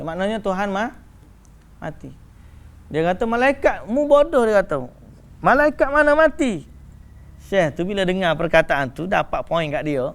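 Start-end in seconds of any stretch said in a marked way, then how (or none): 3.3-6.7 scornful shout. Retold or imitated. Retold heated exchange.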